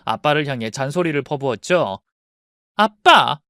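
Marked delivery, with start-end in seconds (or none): 2.8-3.4 loud sharp call; angry call. Acted. Daughter bursting in on her father, loud angry call. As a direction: storm in calling him.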